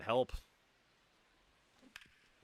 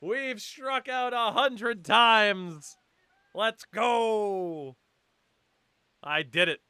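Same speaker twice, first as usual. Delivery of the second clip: high, loud mock triumphant yelling; sarcastic celebration; 13 semitones higher, 12 dB louder, much slower than usual. Real, not acted. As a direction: cheer it like a sarcastic victory. Mock celebrating hundredth strikeout.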